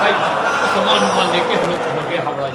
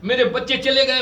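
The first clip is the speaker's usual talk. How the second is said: ordinary talk, nothing marked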